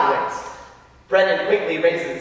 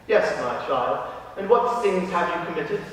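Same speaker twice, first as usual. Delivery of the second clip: calm priest; 3 semitones lower, 4 dB quieter than usual. Acted. Priest hears Frankie's first confession, low and gentle. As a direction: invite the child gently to confess.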